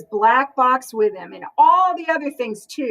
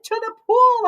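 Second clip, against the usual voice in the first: high shout; yelled; 9 semitones higher than usual. Acted. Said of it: "I yelled".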